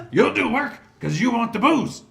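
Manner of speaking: high, very loud scolding yell; full-volume bossy outburst; angry boss shout. Acted. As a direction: holler it at a lazy underling. Boss kicks and orders gnoll.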